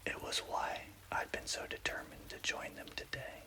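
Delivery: ordinary delivery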